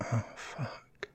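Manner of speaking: unvoiced breathy gasp; whispering. Breathy aroused exhale.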